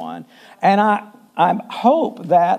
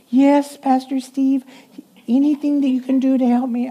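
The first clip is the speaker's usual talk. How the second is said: imitating the secretary; 6 semitones higher than usual. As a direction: answer the pastor in a tiny earnest voice. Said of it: "She goes".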